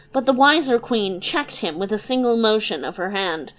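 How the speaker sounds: plain reading voice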